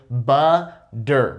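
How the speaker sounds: "Butter" broken into two beats, crisp D; exaggerated D sound. Shown as a demonstration. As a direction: hit a hard D starting the second half of "Butter". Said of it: "exaggerate with that D sound".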